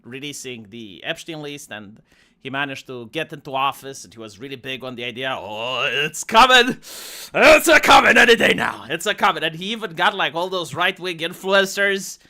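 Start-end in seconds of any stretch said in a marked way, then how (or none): 5.4-9.4 loud, hollered mock hype, pitched high; hyped-up mock shout. Host mocking the hype over the promised list, mock shouting. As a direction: holler it like an over-excited true believer.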